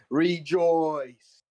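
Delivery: booming ancient shout; celestial shout. Acted. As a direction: proclaim it like a divine cry. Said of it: "he screams the word in an ancient celestial".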